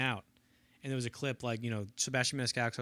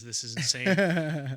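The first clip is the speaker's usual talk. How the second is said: slow, louder, unlike own voice; awed aside; 8 dB louder, much slower than usual. Retold or imitated. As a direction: stretch it out in disbelief. Cannavale whispered aside.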